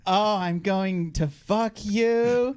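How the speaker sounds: slow, loud playful threat; mock threat. Real, not acted. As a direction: drag out a hammy threat.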